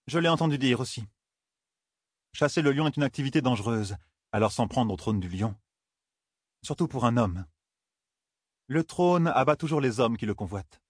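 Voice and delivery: a touch of a scheming lady's voice; Marande, needling courtier. Acted. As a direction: pitch it up slightly for a needling courtier.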